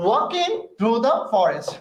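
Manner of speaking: "walking through the forest" drawn out very slowly, word by word; deliberately slow pronunciation. Shown as a demonstration. Teacher modelling the phrase for students, very slow careful "walking through the forest". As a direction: stretch every word of "walking through the forest" so the class hears each one. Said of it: "ইচ্ছা করে বলছি".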